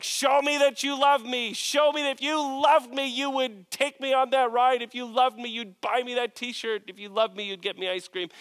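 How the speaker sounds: lightly voiced whiny kid, high pitched; a hint of a pleading child; demanding child. Pastor voicing a child demanding proof of love. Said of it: "every one of them sounds like".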